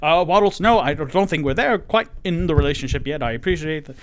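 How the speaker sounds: hammed-up awkward panic, stomping away; flustered giant. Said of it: "stomping like".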